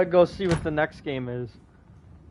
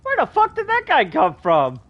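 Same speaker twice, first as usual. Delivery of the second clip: higher, louder startled cry; startled outburst; 11 semitones higher, 3 dB louder than usual. Real, not acted. Player startled by a character popping up, shocked outburst. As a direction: jump at the sudden stranger.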